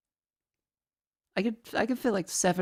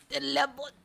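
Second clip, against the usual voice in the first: put-on higher, accented lemon; mock Greek accent; 5 semitones higher than usual. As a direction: say lemon like an old Greek uncle. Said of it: "it very much is like this".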